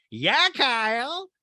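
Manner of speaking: high, drawn-out yell back; Rinna. Retold or imitated. Housewife yelling back to Kyle, high mimicked holler. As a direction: stretch out a loud mock answer. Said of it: "And Rinna yells back".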